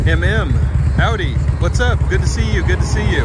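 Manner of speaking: high, loud, beaming hellos to the chat; excited greeting. Real, not acted.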